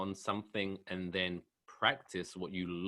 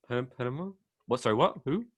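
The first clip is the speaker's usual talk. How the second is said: louder, puzzled questioning echo; mock bafflement; 6 semitones higher, 6 dB louder than usual. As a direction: act out not catching the name. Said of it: "That's what I was like".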